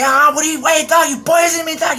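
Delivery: high, loud panicked shout; panicked yell. Retold or imitated. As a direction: yell like the drug just hit your face. Snorting powder, thinks he's poisoned.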